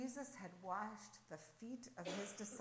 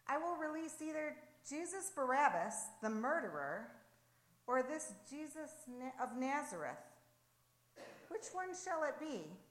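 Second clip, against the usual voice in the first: higher-pitched proclamation to a crowd; public announcement; 6 semitones higher, 4 dB louder than usual. A narrator's reading. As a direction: put the choice to the whole crowd. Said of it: "he announced to the leaders and to the crowds".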